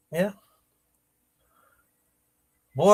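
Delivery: ordinary talk, nothing marked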